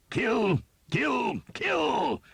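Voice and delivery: very high, loud chant of kill, rising each time; battle cry. A narrator's reading. Soldier urging the slaughter, high and loud. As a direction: scream it like a man in the melee.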